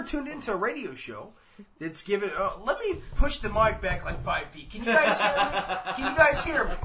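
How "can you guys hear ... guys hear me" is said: raised, higher voice, half laughing; mock loud calling. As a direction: pretend to shout from across the room. Pushes mic back five feet.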